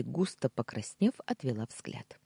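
plain reading voice

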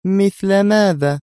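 slow, high, drawn-out question; oddly relaxed. A narrator's reading. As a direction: ask it lazily, stretching the words. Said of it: "سألتها نادية في استرخاء عجيب". (باسترخاء عجيب)

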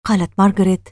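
ordinary delivery, nothing marked

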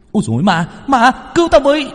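high, loud yell for help; frantic high-pitched wail; panicked echoing cry. A narrator's reading. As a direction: join in the screaming, voice shooting up. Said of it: "cũng kêu lên".

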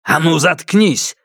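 curt shout. A narrator's reading. Husband silences his pleading wife, mildly shouted rebuke. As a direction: silence her with a restrained yell. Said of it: "крикнул на неё муж".

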